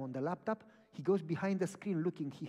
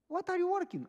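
surprised vet; 14 semitones higher, 7 dB louder than usual. Retold or imitated. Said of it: "I said".

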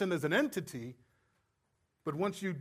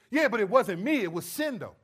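loud, higher-pitched mock excuse; defensive protest; 4 semitones higher, 6 dB louder than usual. Sinner shifting blame onto sin, defensive protest. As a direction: protest your innocence, playing it up.

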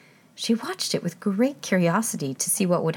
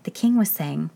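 ordinary delivery, nothing marked

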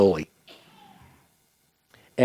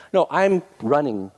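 usual speaking voice

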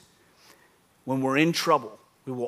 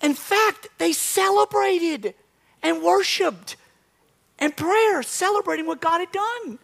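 raised, high preaching voice; preaching at full force; 4 dB louder, slower than usual. Acted. Pastor stirring up the church, preaching with full force. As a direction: proclaim it to the congregation. High-energy opening of sermon.

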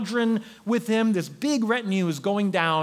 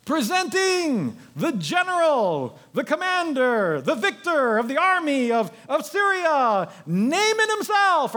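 slow, high, grand announcer; pompous court herald, loud; herald announcing; 8 semitones higher, 3 dB louder, slower than usual. Acted. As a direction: proclaim him like a royal herald.

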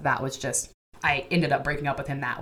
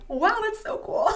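giddy. Retold or imitated. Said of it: "And I was like".

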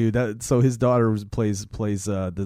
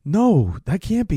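disbelief; 7 semitones higher, 5 dB louder, slower than usual. Retold or imitated. Guy in denial.

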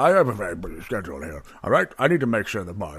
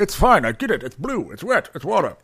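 defensive outburst; 8 semitones higher, 8 dB louder than usual. Acted. Barman snaps defensively.

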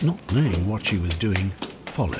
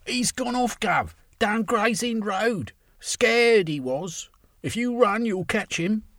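cockney worker shouting; 14 semitones higher than usual. A narrator's reading. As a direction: call back from the crowd. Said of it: "A lone voice shouted".

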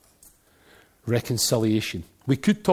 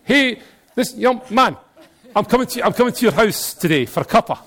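broad Glaswegian call, starting high and loud, easing at the end; comic Glaswegian self-invitation, settling down; Glaswegian Jesus; 5 semitones higher, 4 dB louder than usual. Acted. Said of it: "Jesus has not got a Glaswegian accent".

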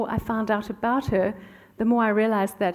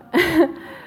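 real burst of laughter, pitched up; high bright laugh; 9 semitones higher, 4 dB louder than usual. Real, not acted. Author laughing to the audience about the old letter, high laugh. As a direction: laugh out loud, amused at herself.

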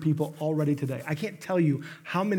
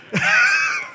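sudden shrieking laugh, much higher and louder; high burst of laughter; 7 dB louder than usual. Real, not acted. Pastor cracks up telling about Dave's tour, loud laugh. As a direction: let a squealing laugh burst out. Laughing at own joke mid-story.

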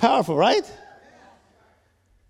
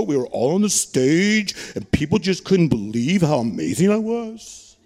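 man's voice; 8 semitones lower than usual. Pretend. Preacher mocking a congregant who brags about being on stage. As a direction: puff up like a bragging show-off man. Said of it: "Let's do a man's voice".

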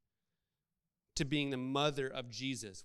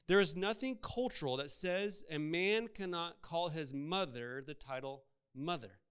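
ordinary delivery

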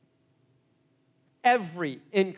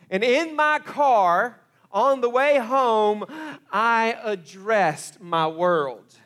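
louder and higher, drawn out slow; slow building intensity; 5 semitones higher, 10 dB louder, much slower than usual. Real, not acted. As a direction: lean into each word with weight. Preacher builds to a climax.

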